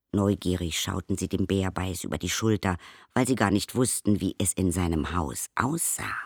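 ordinary delivery

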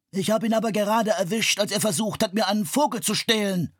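loud, high indignant grumble; scolding. A narrator's reading. The bird seller scolds Leonardo about the boy, indignant. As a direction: complain he caught a thief. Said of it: "schimpfte der Vogelhändler".

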